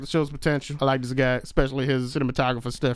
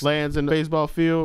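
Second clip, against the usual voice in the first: normal talking voice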